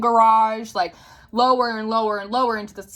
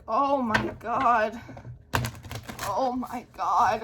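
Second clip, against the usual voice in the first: slow, drawn-out, softer disbelief; retold stunned dread; 5 dB quieter, much slower than usual. Narrator realizing the boy matches her dream, slow stunned reaction. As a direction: let each word sink in slowly. Retold shock at hearing his clothes.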